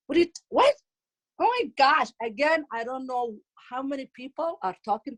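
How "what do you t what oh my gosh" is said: high, louder outraged daughter; losing it. Acted. Daughter reacting to mom's makeup request, losing it. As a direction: sputter in mock outrage, pitch jumping. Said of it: "She loses it".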